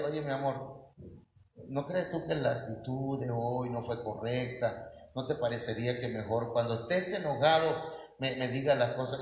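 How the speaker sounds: voz suave, grave y cariñosa; esposa conciliadora. Acted. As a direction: habla bajito, razonando con tu pareja. Wife gently talking to calmed husband. (tono suave y conciliador)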